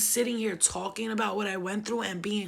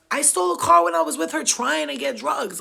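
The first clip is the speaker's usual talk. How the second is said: louder, higher confession; emphatic louder retelling; 6 semitones higher, 8 dB louder than usual. Real, not acted. Confessing to viewers, louder and higher. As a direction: hit the repeat harder than the first time.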